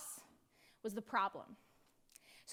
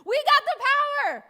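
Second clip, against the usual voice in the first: loud boast; 9 semitones higher, 11 dB louder than usual. Shown as a demonstration. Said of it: "but I'll just say it".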